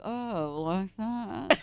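clenched-jaw New England lockjaw accent. Shown as a demonstration. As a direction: lockjaw accent like an old Gilligan's Island socialite. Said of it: "Can you do New England a-, lockjaw accent?".

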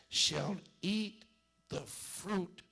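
usual speaking voice

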